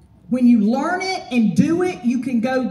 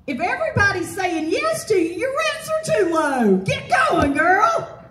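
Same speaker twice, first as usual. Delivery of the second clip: fired up; 7 semitones higher than usual. Real, not acted. Speaker firing up the property managers about raising rents, a real excited shout. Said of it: "I get fired up about it".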